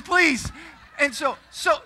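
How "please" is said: loud, high mock begging; retold desperate plea. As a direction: beg for your life, hammed-up. Begging God on the ride.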